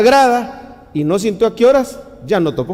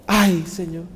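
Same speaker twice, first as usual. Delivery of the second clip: gemido suplicante; 4 dB quieter, slower than usual. Shown as a demonstration. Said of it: "Esa es la más corta".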